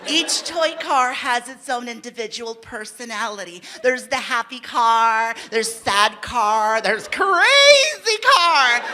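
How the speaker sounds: lightly voiced studio director pitching a show; a hint of a director selling a cartoon; director's pitch. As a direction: pitch it like a slick executive, measured and careful. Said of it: "the director comes out and she was really cool".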